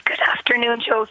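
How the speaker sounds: hoarse, half-whispered greeting from a sore throat; croaky. Real, not acted. Caller with a sore throat greets the host, croaky. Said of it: "Sorry, I'm a bit croaky".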